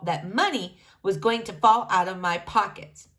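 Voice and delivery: ordinary delivery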